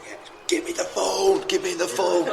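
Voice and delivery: can't wait to snatch the phone; excited grabbing for the phone. Retold or imitated. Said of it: "and she went".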